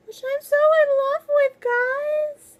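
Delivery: high, loud gushing squeal; squealing delight. Real, not acted. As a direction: squeal it like a fangirl. Gushing over bookmark.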